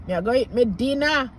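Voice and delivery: quick Jamaican patois sentence. Shown as a demonstration. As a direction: say it like a Jamaican local, fast. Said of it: "Jamaican patois".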